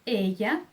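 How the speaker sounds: ella with a closed first E, "ê-ya". Shown as a demonstration. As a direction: say "ella" with a narrow ay-like E. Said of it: "tem o som fechado".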